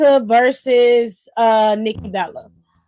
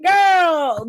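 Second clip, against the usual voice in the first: mock shout; 6 semitones higher than usual. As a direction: call her out with a pitched-up shout. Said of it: "She was like".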